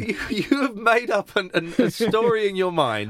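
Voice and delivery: playful accusation of making it up; indignant. Real, not acted. Heated teasing denial.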